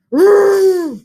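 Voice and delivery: loud, high roaring cry; a big hammed-up roar; angry bear roar. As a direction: let out a cross bear's roar over losing. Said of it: "Old Bear roared".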